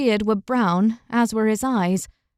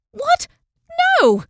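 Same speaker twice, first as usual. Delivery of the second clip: very high startled "What?"; shocked outburst; 4 dB louder than usual. A narrator's reading. Una shocked by the knight's claim. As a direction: jolt up in disbelief.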